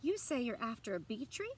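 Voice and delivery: ordinary delivery, nothing marked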